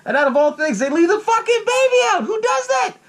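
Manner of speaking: high-pitched exasperated outburst; incredulous outrage. Real, not acted. Viewer appalled that parents left the baby out, high incredulous outburst. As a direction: blurt it out high, appalled and half laughing.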